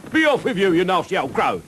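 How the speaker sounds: put-on loud, high shout of an old serving woman. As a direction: shoo the bird away from the window. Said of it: "she shouted".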